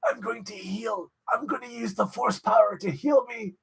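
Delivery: high, strained villain impression; put-on evil old emperor voice; Palpatine. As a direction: croak it out like a scheming Sith lord. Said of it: "he's sort of like the Palpatine".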